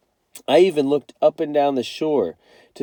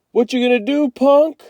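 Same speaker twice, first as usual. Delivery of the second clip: loud, high jeering yell; taunting shout; 13 semitones higher, 8 dB louder than usual. A narrator's reading. Byron taunts Kenny as he walks off, shouting. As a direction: fling it back over the shoulder. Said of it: "Byron shouted over his shoulder".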